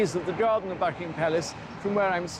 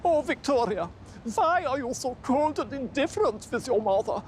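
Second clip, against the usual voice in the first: pleading rejected mother; 9 semitones higher than usual. Retold or imitated. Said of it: "her mother wrote to her".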